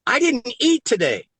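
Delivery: a lightly voiced astonished roommate; marveling confession; roommate amazed.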